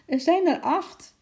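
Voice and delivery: a flustered lady calling after the father. A narrator's reading. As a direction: shout it after him as he runs off. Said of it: "riep mevrouw Jobs hem na".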